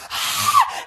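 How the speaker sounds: piercing, very high shriek; scream. Retold or imitated. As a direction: scream as a spider lands on your head. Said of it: "I'm like".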